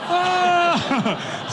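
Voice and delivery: sudden very high excited shriek; shriek of joy. Retold or imitated. Team hearing the real funding figure, a high joyful shriek. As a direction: squeal like the news just landed.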